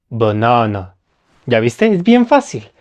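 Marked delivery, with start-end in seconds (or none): 0.1-1.0 "banana" with relaxed, barely open schwa in first and last syllables. Shown as a demonstration. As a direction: say "banana" low, lips slightly open on the weak vowels. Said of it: "vamos a abrir los labios ligeramente".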